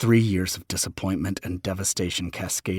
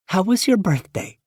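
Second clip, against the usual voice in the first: higher, brighter motherly voice; 12 semitones higher, 6 dB louder than usual. Acted. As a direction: play the mom, asking gently.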